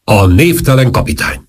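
high, ceremonial announcing voice; loud announcement. A narrator's reading. Major presenting the captain to conspirators, proclaimed announcement. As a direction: announce his arrival to the room. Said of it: "és hangosan mondta".

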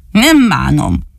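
lightly voiced sulky little boy; sulky doll voice. Dani answers the dog from the tree, lightly voiced sulking. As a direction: mope the words out.

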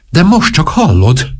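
alarm. Acted. Alarmed king presses the duchess.

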